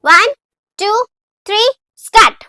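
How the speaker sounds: shouted count, high; loud countdown. Acted. Student counting the class in, shouted high. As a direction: call the count to start everyone.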